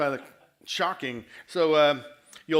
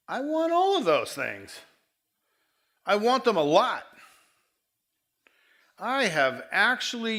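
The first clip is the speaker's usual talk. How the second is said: impassioned confession; 6 semitones higher, 6 dB louder than usual. Real, not acted. Preacher's confessional climax.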